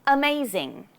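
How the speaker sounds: "amazing" with a short relaxed first uh; "amazing" opening on a lazy schwa. Shown as a demonstration. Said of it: "So the schwa sound".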